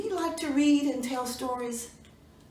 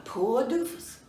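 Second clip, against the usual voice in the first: usual speaking voice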